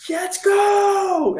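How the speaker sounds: slow, drawn-out mock shout; high stretched yell of yeah let's go; excited cheer. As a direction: cheer it like the crowd went wild. Retelling cheering in theater.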